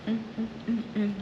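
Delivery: humming through a mouthful of food; happy mm sounds while chewing; mouth full. Real, not acted. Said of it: "talk with my mouth full".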